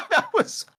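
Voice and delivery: high breaking laugh through words. Real, not acted. Recalling the team's clown show, laughing at the memory. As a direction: laugh while trying to talk. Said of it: "I'm laughing".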